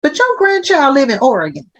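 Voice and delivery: high, incredulous retort; playful scolding. Retold or imitated. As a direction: call them out in mock disbelief. Acted retort to reluctant patient.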